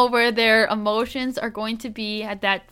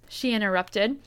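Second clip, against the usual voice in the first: usual speaking voice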